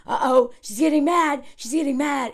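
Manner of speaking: hammed-up panicky inner voice; little dictator alarm. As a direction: sound the warning like an anxious inner critic. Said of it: "that little dictator is like".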